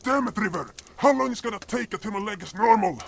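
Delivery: high, anxious, hurried pleading; panicked complaint. Acted. Cop panics to healer about swollen leg, high and anxious.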